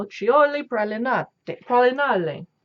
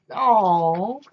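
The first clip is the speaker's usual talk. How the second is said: ordinary delivery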